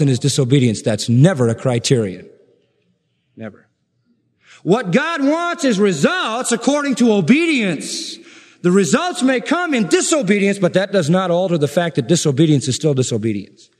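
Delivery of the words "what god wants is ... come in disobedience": high, measured pulpit declaration; emphatic preaching. Real, not acted. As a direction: proclaim it with insistent force. Preaches at full force.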